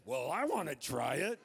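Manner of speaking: mock grumpy dad demanding a turn; dad wanting in. Dad's voice in the story.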